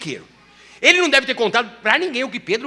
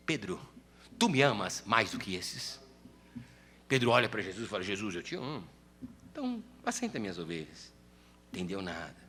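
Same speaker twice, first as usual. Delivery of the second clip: much lower and softer gentle dialogue; tender, hushed; 10 semitones lower, 9 dB quieter than usual. Acted. Jesus asking Peter if he loves him, tender and hushed. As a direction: speak it tenderly, like a close secret.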